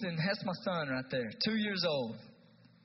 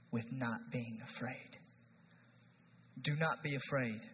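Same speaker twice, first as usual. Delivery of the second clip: ordinary talk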